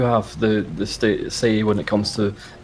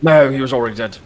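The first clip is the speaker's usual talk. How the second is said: put-on high, stiff old soldier; a proud veteran sizing up a recruit; old army man voice; 5 semitones higher, 6 dB louder, faster than usual. Mr Muntz voice.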